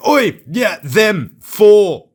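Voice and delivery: blunt bursts, one word at a time; imitated loud tourist order; shouting tourist. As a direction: bark it out like a tourist pointing at things. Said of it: "point and shout like most tourists do".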